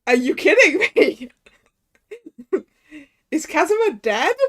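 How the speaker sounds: loud, incredulous cry; shocked outburst. Acted. Accused man learning Kazuma is dead, loud disbelief. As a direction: burst out in acted shock.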